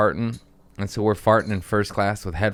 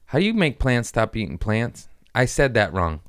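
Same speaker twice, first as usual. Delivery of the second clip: normal talking voice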